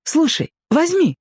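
put-on very high girl's voice, mild call. A narrator's reading. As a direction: call urgently as a girl, without much volume. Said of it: "вдруг закричала девушка". (девушка окликает высоким голосом)

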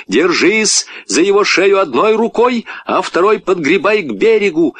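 high, urgent instructions; commanding. A narrator's reading. Boy instructing girl swimming with dog, commanding. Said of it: "командовал Петя".

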